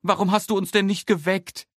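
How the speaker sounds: Mama reproaching. A narrator's reading. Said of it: "sagt Mama".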